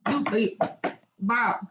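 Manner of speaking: ordinary talk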